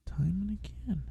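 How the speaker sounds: whispered title. Real, not acted. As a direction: breathe the words softly.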